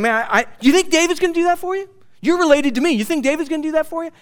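high, fast, incredulous taunt; mocking Saul. Preacher acts out Saul taunting.

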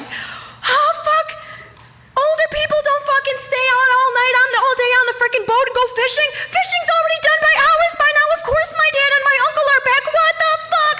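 high, fast, loud squealing rant; hammed-up panic. Realising dad and uncle are back, shrieking mock panic.

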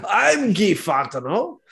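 high, drawn-out mock French accent; bad French accent. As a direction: put on a hammy French Canadian accent. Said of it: "It wasn't even a bad French accent".

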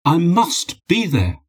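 high, drawn-out insistence; insistent. A narrator's reading. Husband telling his wife he cannot stay away, insistent. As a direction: press each word slowly and urgently. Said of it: "Dmitri insisted".

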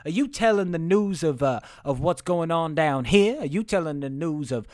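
put-on drawled Southern accent, slowing toward the end; Southern town leader. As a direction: stretch it out like a suspicious Southern boss. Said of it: "he said".

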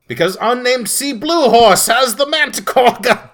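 high, loud cheer; triumphant shout. Retold or imitated. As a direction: shout it like a hero. Triumphant announcement.

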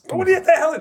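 high, indignant "what the hell"; startled outburst. Retold or imitated. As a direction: snap at him in shock. Reacting to stranger pulling arm hair.

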